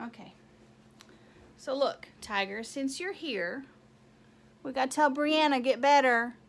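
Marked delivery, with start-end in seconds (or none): 4.6-6.4 loud cheer. Real, not acted. Owner with cat calling a get-well wish to Brianna, loud. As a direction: call it out loud and bright to the camera.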